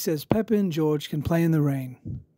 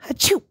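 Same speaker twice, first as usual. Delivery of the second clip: sneeze; 11 semitones higher than usual. Acted. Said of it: "replies George".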